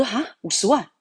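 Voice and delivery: sharp high gasp of disbelief at the sum; shocked surprise. Retold or imitated. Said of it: "他听到我这个很惊讶的口气". (惊讶地高声反问)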